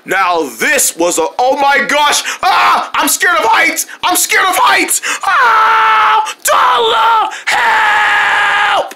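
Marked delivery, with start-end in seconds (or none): 1.4-5.0 high shouted fear of heights; high scared shouting; panicked yell. Acted. Panicking mid-flight. 5.3-8.9 high loud shouted plea; panicked high yell; calling for help. Acted. Calling for help mid-flight.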